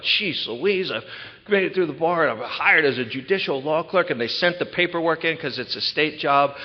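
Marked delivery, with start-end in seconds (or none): 0.0-4.1 excited. Retold or imitated. Retelling joyful news to family.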